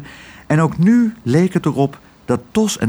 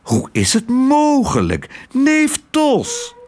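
put-on high, slow, gushing old-aunt voice; 12 semitones higher, much slower than usual. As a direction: coo over a long-lost nephew. Aunt greets her nephew.

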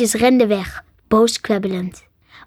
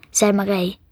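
ordinary delivery, nothing marked

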